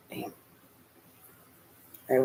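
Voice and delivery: ordinary delivery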